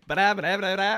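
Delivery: stuttering cartoon pig babble; Porky Pig stammer. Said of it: "You sounded just like the Looney Tunes pig".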